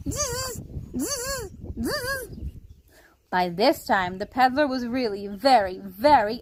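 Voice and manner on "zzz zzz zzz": very high buzzing zzz; monkey chatter. A narrator's reading. Monkeys copying the peddler with high buzzing. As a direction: buzz back like mocking monkeys.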